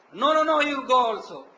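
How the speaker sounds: firm retold order, pushed up and out; insistent overruling. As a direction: imitate an elder cutting off excuses. Gurudev overrules disciple's excuses.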